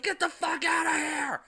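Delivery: high, loud frantic yell; retold shriek at the dinner guests; shouted. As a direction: scream it at them in panic. Woman yells at the parents.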